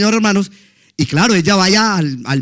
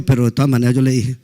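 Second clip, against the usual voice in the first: laughing aside; 8 semitones lower, faster than usual. Real, not acted. Admits inventing it, laughing.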